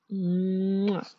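a soft smacking kiss on the forehead; light affectionate forehead kiss; kiss sound. Acted. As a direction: plant a gentle kiss into the mic. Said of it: "A kiss on the forehead".

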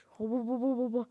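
buzzing hobo bo bo sound; robot vacuum noise. Retold or imitated. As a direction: imitate a robot vacuum humming. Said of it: "diye çekiyor böyle".

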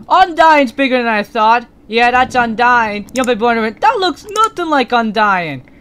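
usual speaking voice

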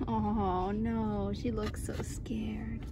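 soft hushed whisper. Real, not acted. Owner whispering about the scared new puppy, hushed. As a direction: whisper so you don't startle her.

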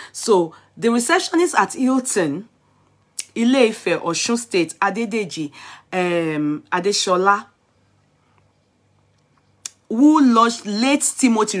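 a touch of newscaster; news read. Acted. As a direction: read the headline out to the viewers. Reads news report aloud.

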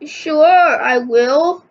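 plain reading voice

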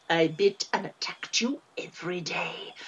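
dark low whisper. Acted. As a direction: breathe it menacingly. Twin recalls being a monster.

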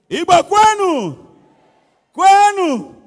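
loud high shout to the crowd; rally call. Acted. Comedian rallies the Igbo audience with a loud shout.

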